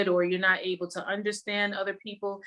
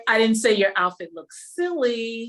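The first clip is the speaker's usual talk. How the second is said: louder line, "silly" punched hardest; stress on silly; 3 semitones higher, 6 dB louder than usual. Shown as a demonstration. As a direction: land hard on "silly". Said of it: "anywhere you put the emphasis".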